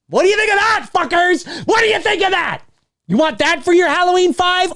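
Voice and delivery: very high, strained yelling; boastful high-pitched challenge; triumphant mock shout. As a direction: crow it at the audience in a hammed-up screech.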